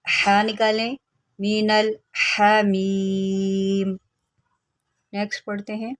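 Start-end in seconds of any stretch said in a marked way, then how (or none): 1.4-4.0 slow chanted tajweed; Quran recitation. Shown as a demonstration. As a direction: draw out Hamim in a recitation tune. Recites the verse.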